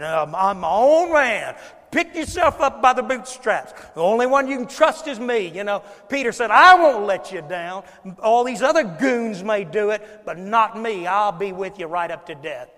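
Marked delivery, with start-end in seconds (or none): 0.0-5.5 loud, higher-pitched tough-guy boast; big tough man. Acted. Preacher mimicking Peter's bragging, a loud tough man. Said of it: "this is the big tough man". 6.5-12.7 booming, emphatic promise; Peter's loud boast. Acted. Peter swearing loyalty to Jesus, loud and cocksure.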